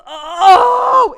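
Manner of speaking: very loud, high frustrated groan; exasperated groan. Real, not acted. Exasperated outburst at the leasing office.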